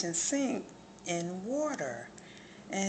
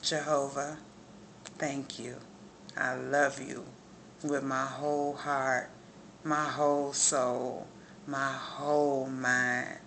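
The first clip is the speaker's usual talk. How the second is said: heartfelt prayer; 4 semitones lower than usual. Real, not acted. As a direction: pray from the whole heart, lingering on each thanks.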